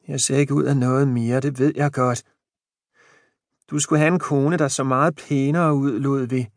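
lightly voiced old wife, downcast; a mildly self-pitying old woman; a hint of a sad old wife. A narrator's reading. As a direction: she feels she's lost her looks.

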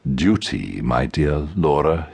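a hint of mock gravity, drawn out; lightly voiced suitor playing solemn; mock solemn man. A narrator's reading. Angus speaks in mock ceremony.

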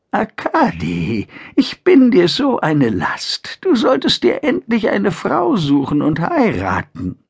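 put-on shaky voice of a frail old mother; trembling voice. A narrator's reading. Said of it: "sagte sie mit zittriger Stimme".

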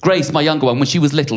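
ordinary talk, nothing marked

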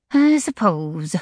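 slow, sighing reluctance. A narrator's reading. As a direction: sigh the words out grudgingly. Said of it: "sighed Nadine".

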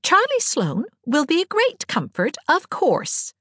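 high-pitched, arch sarcasm; sarcastic. A narrator's reading. Anne agreeing with Diana about Charlie Sloane, sarcastically. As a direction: agree with exaggerated, mocking emphasis. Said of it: "agreed Anne sarcastically".

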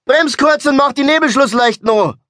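high, loud, urgent yell; shouted command. Acted. Passenger screams instructions at the driver, urgent shout. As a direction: shout the instruction in one excited burst. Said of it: "schrie ich".

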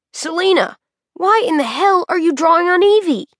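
high-pitched, incredulous SoCal surfer; SoCal surfer accent. A narrator's reading. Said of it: "in his SoCal surfer accent".

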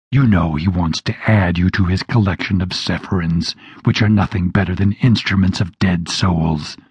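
low demon spitting words; spat out. A narrator's reading. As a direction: spit the words out in contempt. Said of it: "he spat out these words".

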